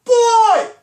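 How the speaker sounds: very high, loud, stretched one-word holler; booming, high-pitched parental summons; yelled call. Retold or imitated. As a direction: yell for all the kids at once. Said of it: "I yelled".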